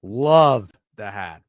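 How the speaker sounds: slow, drawn-out admiring compliment. Real, not acted. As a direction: stretch it out, admiring. Admiring co-host's hat again.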